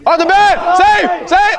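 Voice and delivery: high, loud excited yelling; excited shout. Real, not acted. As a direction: shout at the play. Shouting on a close play.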